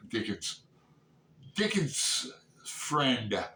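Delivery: ordinary talk, nothing marked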